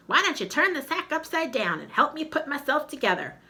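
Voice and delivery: talking skull. Acted. Skull asks for help.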